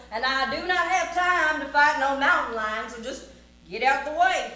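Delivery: loud, emphatic cowboy tirade; blustering challenge. Acted. Cowboy orders mountain lion out of his way, blustering. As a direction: square up to the beast with hammy bluster.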